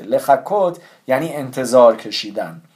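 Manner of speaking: usual speaking voice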